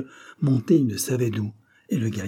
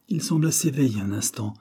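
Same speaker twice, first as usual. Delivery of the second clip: ordinary delivery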